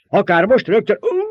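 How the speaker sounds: high, loud shout; shout into outcry. A narrator's reading. Baron holding the girl, shout cut by a cry. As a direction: shout eagerly, then break into a cry.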